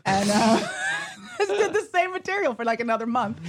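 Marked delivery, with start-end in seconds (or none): 0.6-1.3 a high squeal of a laugh; high burst of laughter. Real, not acted. 1.4-3.4 laughing. Real, not acted. Laughing through story.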